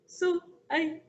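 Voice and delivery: a tiny mouse begging with chattering fear; frightened mouse pleading with the lion; stammering scared mouse. Acted.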